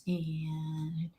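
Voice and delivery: slow hushed whisper. Real, not acted. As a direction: whisper it slowly. Whispering while scrolling chat.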